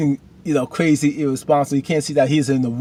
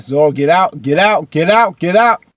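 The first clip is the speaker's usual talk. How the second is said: loud chant climbing higher each time; urgent warning; 4 semitones higher, 7 dB louder than usual. Acted. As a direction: urge her out, building with each repeat. Urges Zoro to leave.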